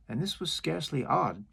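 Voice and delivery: ordinary delivery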